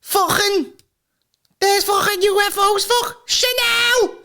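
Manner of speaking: high, hammed-up Scouse voice; Scouse accent. Scouser pilot spotting UFOs, hammed-up Scouse accent. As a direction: squeal it in Scouse, dragging the last word. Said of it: "you'll never get a Scouser".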